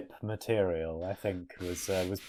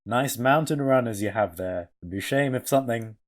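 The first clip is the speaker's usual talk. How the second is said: loud mock-friendly menace; smiling threat; 3 semitones higher, 7 dB louder than usual. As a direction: yell a polite threat with a grin. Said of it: "Fred yells at them".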